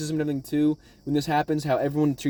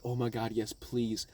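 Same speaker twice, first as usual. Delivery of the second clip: soft, low pleading to oneself; inner voice; 5 semitones lower, 10 dB quieter than usual. Retold or imitated. Viewer's thoughts as Luffy approaches, hushed eager plea. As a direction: drop it low and hushed, relieved. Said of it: "And in my head, I'm like".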